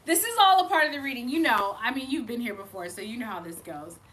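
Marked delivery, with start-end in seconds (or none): 0.0-1.3 laughing aside. Real, not acted. Repeated line, laughing.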